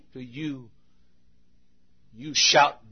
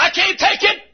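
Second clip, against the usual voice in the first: very high-pitched cry of defeat; acted high outcry; shouting back; 4 dB louder than usual. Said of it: "Shallow shouts back and says".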